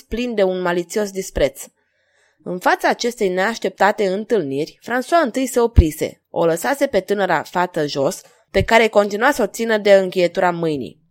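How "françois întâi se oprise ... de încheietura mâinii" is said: ordinary delivery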